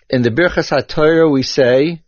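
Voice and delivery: ordinary delivery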